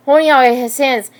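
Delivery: plain reading voice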